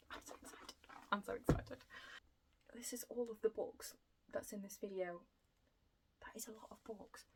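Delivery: breathless whispered excitement, almost no voice; excited whisper. Real, not acted. To viewers about a new jigsaw puzzle and a pile of new books, in a whisper. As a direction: whisper it like a secret thrill.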